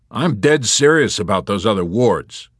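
loud, grim insistence; grimly. A narrator's reading. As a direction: drive the warning home grimly. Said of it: "Yossarian continued grimly".

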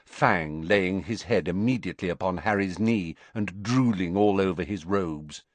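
usual speaking voice

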